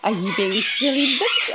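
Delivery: ordinary talk, nothing marked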